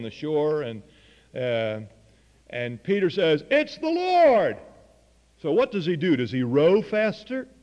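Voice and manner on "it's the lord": excited cry. Retold or imitated. Peter spots Jesus on shore.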